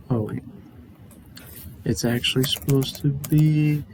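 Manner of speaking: usual speaking voice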